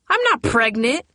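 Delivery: put-on teen denying a rumour, fuming. A narrator's reading. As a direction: snap back, stung and annoyed. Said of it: "Vicky fumed".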